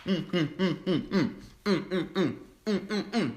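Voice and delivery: rising mm-mm of delight; savoring hums. Real, not acted. Tasting the cake for viewers, delighted humming. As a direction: hum with pleasure while chewing.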